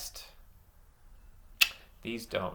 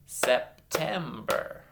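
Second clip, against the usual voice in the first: SEPTEMBER slowly, a gap after every syllable; 2 semitones higher, much slower than usual. Shown as a demonstration. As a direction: clap out "September" piece by piece. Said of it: "clap out some syllables".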